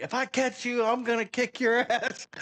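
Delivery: high, mock drill sergeant yell; imitating a drill sergeant yelling from behind. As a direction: bark it like a sergeant chasing a recruit. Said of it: "Just yelling at me".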